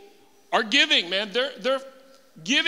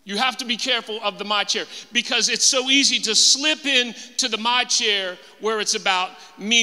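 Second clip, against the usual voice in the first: ordinary talk, nothing marked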